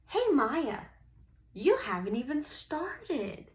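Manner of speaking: Ralph calls out over the fence; calling over fence. Acted. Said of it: "and he said".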